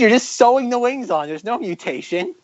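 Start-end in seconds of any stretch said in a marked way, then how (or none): 1.3-2.3 soft laughing words. Real, not acted. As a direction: laugh it out quietly. Laughing at the joke.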